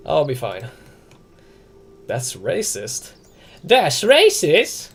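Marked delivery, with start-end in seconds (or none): 3.6-4.9 high, loud, very slow disbelieving question; incredulous. Real, not acted. Streamer to chat repeating their accusation, loud stretched-out disbelief.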